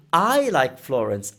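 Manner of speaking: long, emphatic "I"; stress on I. Shown as a demonstration. Teacher implying others may disagree, stress on "I". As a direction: lean on "I" and draw it out. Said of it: "the implication is that you are going to say".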